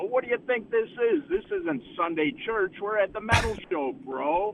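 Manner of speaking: high, far put-on dude voice; hammed-up metalhead comeback; mock rocker retort. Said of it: "And I go".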